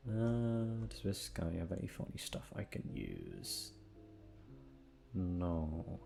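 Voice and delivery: ordinary talk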